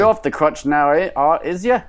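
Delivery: hammed-up London Cockney voice; Cockney accent. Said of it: "terrible Cockney accent".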